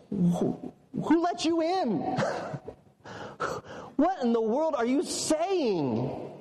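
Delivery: high, slow, drawn-out disbelief; incredulous. Acted. Jesus to the man who interrupted him, high-pitched incredulity. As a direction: stammer it out, utterly baffled by the question. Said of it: "He was saying".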